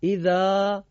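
hamzat al-wasl dropped in اذا; Quranic recitation. Shown as a demonstration. Scene: teacher demonstrates reading to students, recited. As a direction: read اذا with short final a. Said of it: "چه اتفاقی میفته؟".

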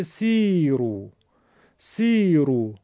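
سیرو with a held 'ee', 'seee-ru'; long ya. Shown as a demonstration. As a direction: stretch the first vowel of سیرو. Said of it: "همون ایشو کوتاه کرده شده کسرش".

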